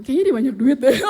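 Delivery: loud, breaking into laughter; laughing protest. Retold or imitated. Protesting to God about giving, laughing loudly. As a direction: object jokingly, cracking up.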